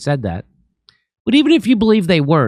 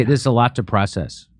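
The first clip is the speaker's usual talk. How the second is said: lower, softer, limp and defeated; mock overwhelmed friend; 7 semitones lower, 4 dB quieter than usual. Mocking friends who dodge the news, lower and softer. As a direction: imitate a friend who can't cope with the news. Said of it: "That's what they say".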